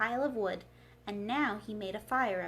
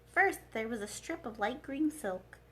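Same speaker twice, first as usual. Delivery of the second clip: usual speaking voice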